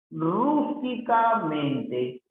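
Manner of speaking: "rústicamente" slow, rising high on "rús"; stretched syllables. Shown as a demonstration. Teacher sounds out word for accent. (alargando las sílabas, despacio)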